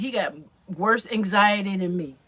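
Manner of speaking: ordinary talk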